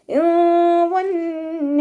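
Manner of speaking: high, slow chanted verse; recitation demo. Shown as a demonstration. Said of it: "അതുപോലെ ഓതുക".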